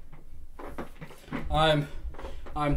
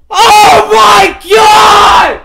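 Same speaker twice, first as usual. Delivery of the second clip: shrieking disbelief, far higher and louder, slow; stunned screaming; 11 semitones higher, 10 dB louder, much slower than usual. Real, not acted. Streamer screaming at chat over a fifty dollar donation. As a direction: scream like you can't believe it.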